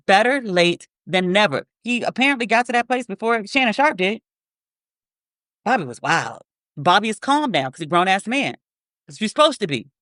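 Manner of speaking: normal talking voice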